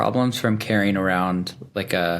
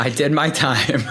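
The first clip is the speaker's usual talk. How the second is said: laughing; 5 semitones higher than usual. Real, not acted. Laughing at his own naivety.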